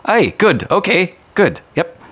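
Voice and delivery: high, loud hurried dwarf; flustered dwarf. Acted. Father rushing past the party, high and loud. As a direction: brush them off nervously.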